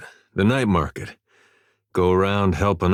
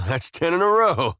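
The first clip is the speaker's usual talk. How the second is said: tag amused; 8 semitones higher than usual. Acted. Amused at mind-reading guessing game.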